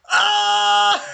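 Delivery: high, loud charging war cry; battle scream. Acted. Viking king charging at Bandit Leo, high loud scream. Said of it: "angry Viking just screaming".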